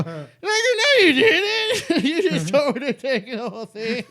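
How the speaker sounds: very high, loud mock wail breaking into laughter; hammed-up wailing protest. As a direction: protest like a stoned crybaby, laughing through it. Acts his stoned protest to Ralphie.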